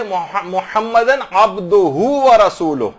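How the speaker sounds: slow recitation; careful recitation. Shown as a demonstration. Teacher recites the correct sentence slowly. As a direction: recite each word slowly. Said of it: "اصل جملہ یہ ہے".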